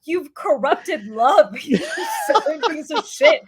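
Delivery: slow, drawn-out outrage breaking into laughter; half-laughing mock fury, rising high at the end; comically mad. Woman scolding her southern fiance in a retold spat, comically mad. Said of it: "makes me so mad".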